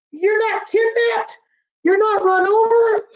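very high astonished questions; amazed high-pitched asking; astonished disbelief. Acted. Relieved disbelief at friend alive.

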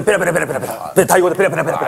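fast babbling gibberish imitating Thai; quick playful mimic of a driver's Thai; mock foreign chatter. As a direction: rattle off fake Thai quickly.

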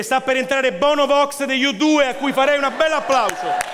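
announcer voice. Acted. Annuncia l'ingresso di [name].

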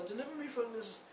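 a hint of a feeble plea; weak sick plea. Preacher voicing a sinner throwing up, feebly pleading. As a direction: plead faintly from the sick bed. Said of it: "while you're barfing your guts out".

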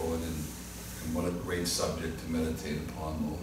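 soft, hushed prayer voice; closing prayer. Real, not acted. Pastor closing the service in prayer, hushed and reverent. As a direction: pray quietly before the congregation.

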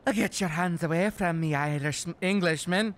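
bad put-on Irish accent; hammy attempt at an Irish brogue. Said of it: "Can you do an Irish accent?".

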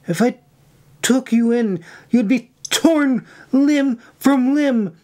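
high-pitched scolding outburst; angry snap. A narrator's reading. Irene refuses to let May sneak in, high snapping rebuke. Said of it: "she snapped at her".

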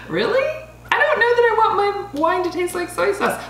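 high, half-laughing disbelief at the taste; mock-incredulous reaction to a soy sauce note; doubtful surprise. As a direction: act skeptical, pitched well up and laughing. Imagined reaction to savory wine.

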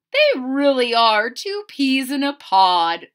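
put-on proud mother beaming at the boys; lightly voiced delighted mother; mother's voice. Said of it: "said Chester's mother".

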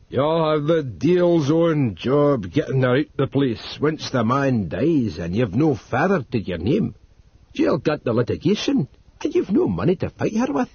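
put-on Northumbrian old servant. A narrator's reading. Narrator voices Dodd.

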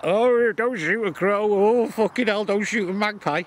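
high, slow whiny mimicry of vegans; mocking vegans. Ranting gamekeeper mocks vegans in a high whiny voice. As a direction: mince it out like a prissy vegan. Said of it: "All they fucking vegans".

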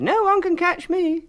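very high, loud, proud little voice; high loud bragging cookie; proud boast. A narrator's reading. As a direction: crow with pride. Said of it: "he said".

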